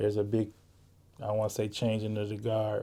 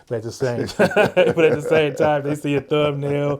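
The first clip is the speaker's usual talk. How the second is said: higher, much louder, amused delivery; laughing; 5 semitones higher, 12 dB louder than usual. Real, not acted. Podcast host on viewers seeing thumbnails, laughing loudly. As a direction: talk through a real laugh, raised and loud.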